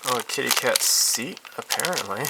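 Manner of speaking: usual speaking voice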